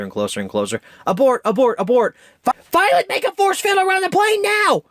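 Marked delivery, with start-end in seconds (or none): 1.1-2.2 high, loud panicked shout of abort; frantic cry as missiles close in; frantic abort call. Retold or imitated. Retelling tense airplane scene. 2.4-4.8 much higher, louder mock-female urgent order; mother ordering Violet to make a force field, urgent; imitating Helen's panic. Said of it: "I can't do female voices".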